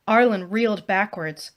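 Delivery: ordinary delivery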